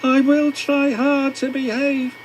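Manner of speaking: contrite engine voice. A narrator's reading. James apologising to the Fat Controller, high and humble. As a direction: sound small and sorry to the boss. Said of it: "said James".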